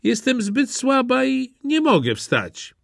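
put-on high, thin old woman's voice; a sick grandma pleading from her bed; frail grandma. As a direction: sound too weak to get up.

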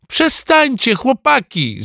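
put-on high-pitched boyish plea; calling out. A narrator's reading. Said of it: "zawołał znowu Alcest".

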